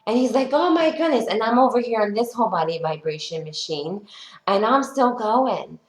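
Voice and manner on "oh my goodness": high-pitched astonished exclamation; mock amazement. Imitating the trainer watching her plank, mock amazement. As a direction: gasp it out high, imitating disbelief.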